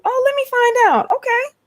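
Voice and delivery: very high, teasing squeal; exaggerated high-pitched intrigue; playful mock surprise. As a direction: react in a high, put-on voice. Playful mock surprise at chat comment.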